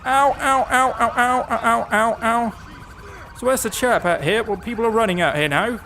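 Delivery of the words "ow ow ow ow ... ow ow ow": hammed-up yelps of ow, high and loud then easing off; playful fake cries of pain, fading; mock pain cries. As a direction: yelp like a cartoon victim being run over. Voices a pedestrian getting hit.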